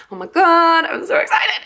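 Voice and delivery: high, squealing with excitement; gushing, high-pitched delight; excited squeal. Real, not acted. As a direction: squeal it like you can't contain yourself. Gushing over Christmas stickers.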